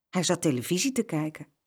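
plain reading voice

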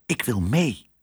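small pleading mouse voice, lightly done; urgent little voice. A narrator's reading. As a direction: say it as a tiny, insistent voice. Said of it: "Een klein stemmetje ... zei dringend". (klein, dringend stemmetje)

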